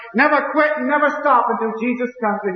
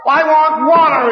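higher, louder, slow anguished plea; crying out for water; 7 semitones higher, 6 dB louder, slower than usual. Retold or imitated. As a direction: cry it out in torment. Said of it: "he cries".

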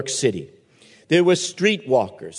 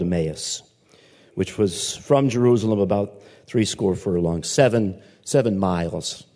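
measured, lower Bible reading; scripture reading; 5 semitones lower than usual. Acted. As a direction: read the passage to the congregation. Reads scripture aloud.

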